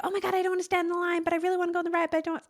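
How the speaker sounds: hammed-up childish complaint; mock whine. Someone wanting the ride but not the line, fast mock whine. Whining about queue for rides.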